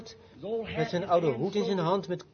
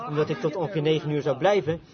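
ordinary talk

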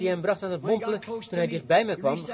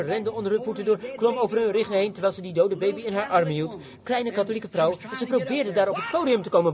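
ordinary talk, nothing marked

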